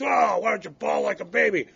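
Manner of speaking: scornful shout as the father; father taunting his son; mocking dad. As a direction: jeer at a crying kid. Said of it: "He goes".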